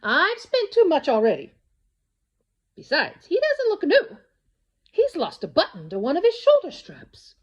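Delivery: lightly voiced mother refusing; mother turning down her daughter's wish; mother's voice. A narrator's reading. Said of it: "her mother sighed".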